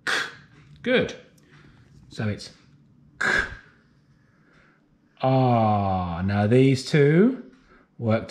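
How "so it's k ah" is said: ordinary talk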